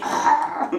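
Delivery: high loud frustrated shout; child's angry cry. Retold or imitated. First grader shouting at her dad, high angry cry. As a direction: mimic a furious little kid. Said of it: "그렇게 화가 나니?".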